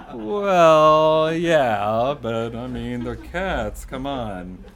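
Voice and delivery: loud, drawn-out "well, yeah", then easing off; mock-defensive. Dad defending his cat poems to his son, mock-defensive. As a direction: protest with comic indignation.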